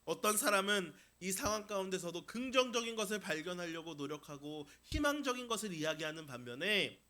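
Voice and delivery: ordinary talk, nothing marked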